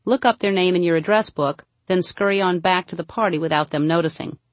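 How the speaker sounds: ordinary talk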